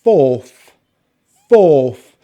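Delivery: loud "fourth" with long rounded "or", no R; careful British "fourth"; long vowel pronunciation. Shown as a demonstration. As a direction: stretch the vowel in "fourth", end on TH. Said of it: "is a long vowel sound".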